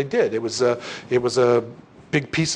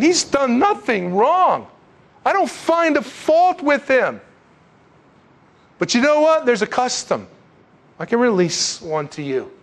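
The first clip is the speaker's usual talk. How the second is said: Pilate; 8 semitones higher than usual. Retold or imitated. Roman governor appeals to the crowd, high and emphatic, easing off. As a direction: plead the case high and slow, then settle into an offer. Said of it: "this is what Pilate's saying".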